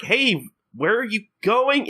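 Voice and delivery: high, drawn-out mock protest; calling after him. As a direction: call out after someone running off. Crowd calls after fleeing Gin.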